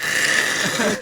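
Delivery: loud voiceless scraping crunch; imitated scraping noise. Telling how the van's back end scraped, imitated noise. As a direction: rasp out the noise of a bumper scraping. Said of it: "the whole back end's like".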